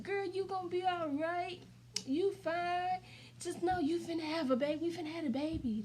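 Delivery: lightly imitated reassuring partner. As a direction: talk a crying partner down. Partner reassures crying mother.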